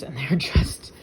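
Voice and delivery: breathy laughing whisper; a delighted little whispered laugh; whispered giggle. Real, not acted. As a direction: giggle it under your breath. Gushing about her macaroons.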